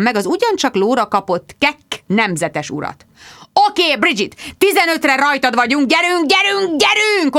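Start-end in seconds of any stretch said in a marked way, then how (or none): 3.7-7.4 yelling. Acted. Producer yells at Bridget through headphones, frantic. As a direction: hurry her with a shout. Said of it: "ordította Richard Finch".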